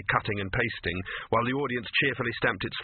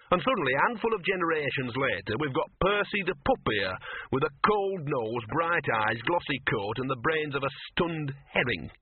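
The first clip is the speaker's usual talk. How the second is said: higher-pitched streetwise mutt; ranting dog; 3 semitones higher than usual. Acted. Dog lecturing Victor on wolf ancestry, indignant. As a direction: hold forth as a scruffy, indignant dog.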